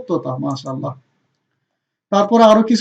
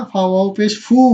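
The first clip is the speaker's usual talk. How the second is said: ordinary talk, nothing marked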